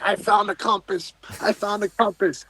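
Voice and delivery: high, hushed-level replay of excited calling; retold excited announcement. Diver telling his buddy he found the compass, excited.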